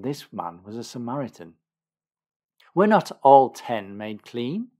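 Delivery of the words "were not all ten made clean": loud, slow questioning exclamation; loud, measured rhetorical question; exclaiming. A narrator's reading. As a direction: exclaim it in disbelief. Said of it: "exclaimed Jesus".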